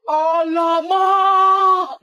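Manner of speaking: slow, loud gasping cry of shock; a friend gasps at the house; astonished gasp. Acted.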